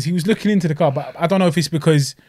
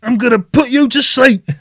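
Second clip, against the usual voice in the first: slow, louder, higher mock fighter threat; 8 semitones higher, 5 dB louder, slower than usual. Imitating a fighter's camera threat, slow and louder. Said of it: "You didn't put me to sleep".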